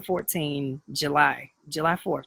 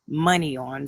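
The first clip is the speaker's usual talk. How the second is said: ordinary talk, nothing marked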